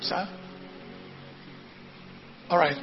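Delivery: ordinary talk, nothing marked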